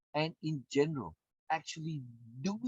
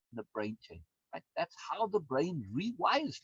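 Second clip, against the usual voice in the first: ordinary delivery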